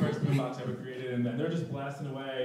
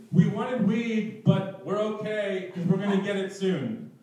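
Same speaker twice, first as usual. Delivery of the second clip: loud, slow, higher yell across the street; 5 semitones higher, 6 dB louder, slower than usual. Retold or imitated. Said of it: "he yells across the street".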